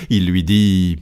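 usual speaking voice

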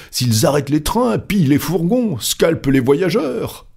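high, agitated escalating list; exclaiming. A narrator's reading. Stuart piles disasters on Fogg, exclaiming. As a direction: pitch up high, each disaster worse. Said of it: "s'écria Andrew Stuart".

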